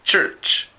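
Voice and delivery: "church" with a sharp ch at both ends; English word demo. Shown as a demonstration. Teacher giving an example of ch, careful English demo. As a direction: model "church" in English, crisp ch. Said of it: "las letras C y H, como en iglesia".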